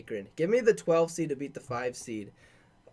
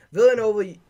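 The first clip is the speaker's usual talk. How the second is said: loud, higher burst on the team name; excited call-out; 5 semitones higher, 9 dB louder than usual. Real, not acted. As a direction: blurt the name out, hyped. Reading out a bracket matchup.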